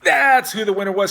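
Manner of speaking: spring the surprise on them; loud, showy winner announcement; triumphant reveal. Pretend.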